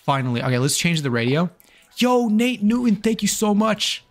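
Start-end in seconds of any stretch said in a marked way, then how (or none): 2.0-4.0 high, slow, hyped thank-you; big cheerful donation thanks; excited shout-out. Real, not acted.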